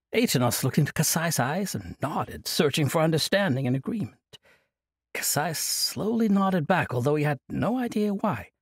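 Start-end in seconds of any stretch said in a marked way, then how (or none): none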